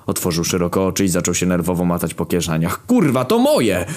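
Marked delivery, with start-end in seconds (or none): 2.9-3.9 high, stretched indignant cry; upset outburst. A narrator's reading. Kamil discovering his own thing was taken, upset high outburst. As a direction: burst out in agitated protest. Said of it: "widziałem zdenerwowanego Kamila".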